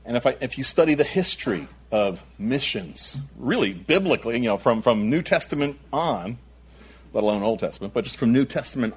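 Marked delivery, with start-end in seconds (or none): none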